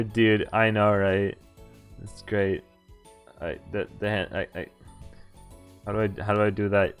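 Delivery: usual speaking voice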